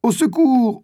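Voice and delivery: high, desperate shout for help; a boy pinned by a jaguar, screaming for help; cry for help. A narrator's reading. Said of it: "Il entend quelqu'un crier".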